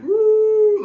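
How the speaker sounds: high, loud dramatic woo; mock shark scream. Reviewer imitating the harpooned shark's scream, hammed-up shriek. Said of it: "a dramatic".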